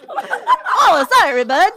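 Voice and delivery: loud, laughing mock Irish accent; bad Irish accent. Teasing co-host for her accent, exaggerated Irish. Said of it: "How do you do an Irish accent?".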